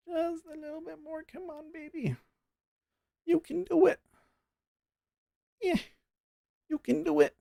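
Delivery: very high, soft pleading squeak; squeaky coaxing. Pretend. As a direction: coax it along in a tiny falsetto. Coaxing the trade in falsetto.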